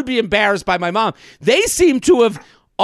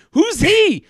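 a lightly voiced gossipy relative, higher and louder; mock the family years later, half-laughing; imagined family gossip; 6 semitones higher, 3 dB louder than usual. Future relatives gossiping about the comedian, lightly voiced higher. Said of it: "I'm, like, imagining".

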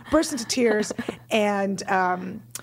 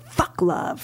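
whispered bitter hiss; 6 semitones higher than usual. Retold or imitated. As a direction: spit it out, jaded. Bitter wedding coordinator voiced.

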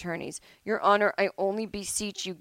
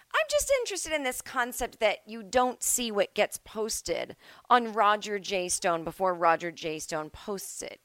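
sarcastic judge; 2 semitones higher than usual. Retold or imitated. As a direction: drag it out with sarcasm.

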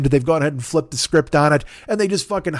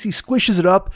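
normal talking voice

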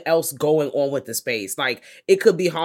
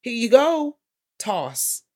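higher, slow, offhand tossing voice; mock careless handing; 6 semitones higher, slower than usual. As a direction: toss it off like throwing scraps. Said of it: "handed to them like".

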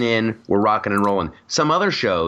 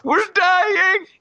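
King Julian wail. Retold or imitated. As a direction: wail it like a squealing lemur. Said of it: "You almost sound like the guy on".

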